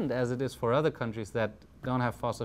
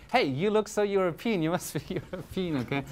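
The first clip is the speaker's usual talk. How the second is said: high, bright receptionist voice with a laugh; chirpy receptionist; 7 semitones higher than usual. Retold or imitated. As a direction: play the excited front-desk clerk, pitched well up. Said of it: "the person at the front desk already goes like".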